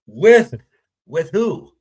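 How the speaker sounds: loud, high laughing ooh of delight; real thrilled laugh asking who; gossipy excitement. Real, not acted. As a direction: squeal at the juicy news. Hearing of the affair.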